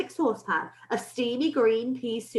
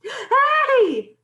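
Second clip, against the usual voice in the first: long, high, startled shout; 13 semitones higher, 7 dB louder than usual. A narrator's reading. Cook feeling a mouse on his leg, shouted. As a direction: yell it high and drawn out. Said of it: "the cook shouted".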